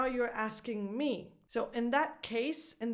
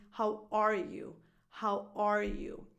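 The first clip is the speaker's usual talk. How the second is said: ARE stressed, falling on "you". Shown as a demonstration. Coach demonstrating the natural music, ARE stressed. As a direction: model it twice, leaning on "are". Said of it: "the natural stress".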